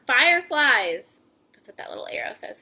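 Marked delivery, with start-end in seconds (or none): 1.5-2.6 whispered aside. Real, not acted. Aside pointing at picture.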